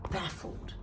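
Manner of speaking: "baffled" breathed softly; whispered savour. Shown as a demonstration. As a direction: savour the word "baffled" in a hush. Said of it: "It's a great word".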